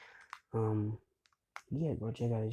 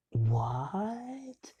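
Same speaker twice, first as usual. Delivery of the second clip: high, drawn-out, rising cry of disbelief; astonished classmates; 8 semitones higher than usual. Retold or imitated. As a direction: stretch it upward in mock shock.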